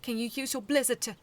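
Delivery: urgent call. A narrator's reading. Urgent plea to Snow mid-fight.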